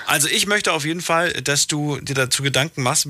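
ordinary delivery

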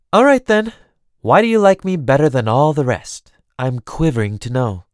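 loud, high start settling into dry teasing; mock-eager cousin. Theron asks Elaine why she likes her, mock eagerness. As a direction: feign eagerness to hear the answer. Theron mockingly begs to know.

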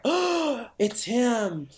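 very high, gasping "oh"; startled realization. Retold or imitated. Girl spotting the killer in her selfies, gasping high. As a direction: gasp as it clicks. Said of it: "She's like".

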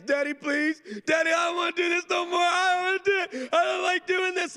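high-pitched mock wailing; hammed-up hysterical whine, fast and high; screaming, crying child. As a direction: imitate a panicking kid's shrieked plea. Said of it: "She's screaming, crying".

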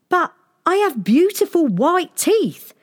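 put-on high dismayed boy. A narrator's reading. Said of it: "he said in dismay".

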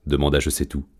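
usual speaking voice

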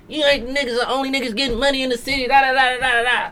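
high, fast mimicking voice; Tweezy. Drunk host bragging in a rival's face, imitated in a high voice. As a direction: rattle off the mock boast way up high. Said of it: "all the Odin face like".

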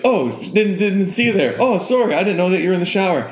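high, apologetic spider voice; talking spider. Acted. Spider to man in the shower, high friendly apology. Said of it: "imagine if spiders could talk to you".

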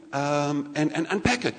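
ordinary talk

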